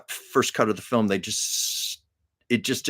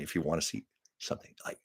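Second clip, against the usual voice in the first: whisper; 6 semitones lower, 12 dB quieter, much faster than usual. Real, not acted. Aside about a terrible movie.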